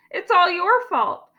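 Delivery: put-on high-pitched child complaint; high, whiny blame; upset little girl. A narrator's reading. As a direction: protest like an aggrieved kid.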